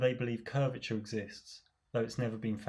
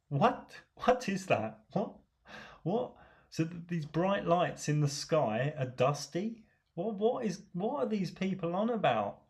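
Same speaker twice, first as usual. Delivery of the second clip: incredulous disbelief; 6 semitones higher than usual. Real, not acted. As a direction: sound genuinely floored by the claim. Baffled by stardust claim.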